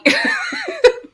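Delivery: squealing high laugh. Real, not acted. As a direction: burst into giggles. Laughing at Max quote.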